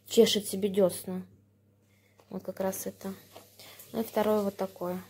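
normal talking voice

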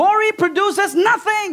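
high, slow, forceful verdict; emphatic preaching. Real, not acted. Preacher telling church worry is useless, emphatic preaching. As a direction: land each word slowly and high.

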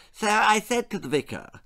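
high, trilled lady's voice; trilling society lady. A narrator's reading. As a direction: trill it grandly, pitched well up. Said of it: "trilled Lady de Trop".

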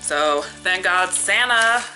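ordinary talk

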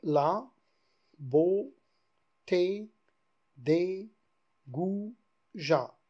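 La, beauté, des, goûts, ja counted one syllable at a time. Shown as a demonstration. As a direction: split goujats into goûts and ja. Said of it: "C'est la deuxième hémistiche".

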